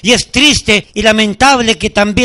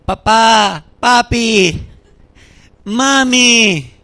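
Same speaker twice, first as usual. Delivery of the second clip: drawn-out, plaintive call; lightly imitated child calling; much slower than usual. Preacher imitating his son in the crib, lightly drawn-out calls. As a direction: lightly mimic a baby calling for parents. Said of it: "Dimas comenzaba".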